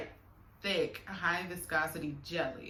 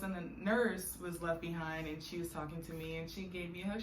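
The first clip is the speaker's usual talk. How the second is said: ordinary talk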